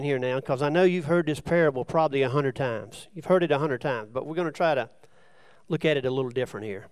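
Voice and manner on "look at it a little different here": usual speaking voice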